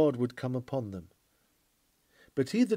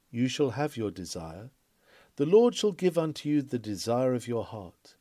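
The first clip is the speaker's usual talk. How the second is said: ordinary delivery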